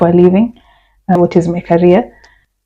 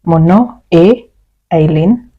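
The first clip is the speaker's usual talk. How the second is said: "Mon nom est" slowed, pause before "Eileen"; slow split model. Shown as a demonstration. Teacher to students, slow split demo. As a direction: spell it out slowly, then the name. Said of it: "you can say".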